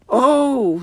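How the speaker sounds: high startled "oh"; startled, high-voiced exclamation; sudden surprise. A narrator's reading. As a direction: cry out in sudden discovery, pitched high. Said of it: "said Susan suddenly".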